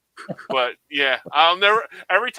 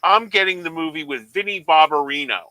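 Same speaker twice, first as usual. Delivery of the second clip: mock clueless mom; 9 dB louder than usual. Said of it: "the mom goes".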